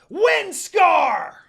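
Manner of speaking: full-throated attack call; battle cry shout. A narrator's reading. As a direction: scream the attack name. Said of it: "Inuyasha yelled".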